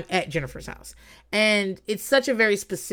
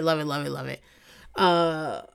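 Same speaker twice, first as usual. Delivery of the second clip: normal talking voice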